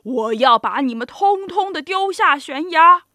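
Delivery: very loud, menacing threat; roared threat at full volume; giant roaring. A narrator's reading. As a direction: bellow it out as a furious giant. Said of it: "巨人咆哮着".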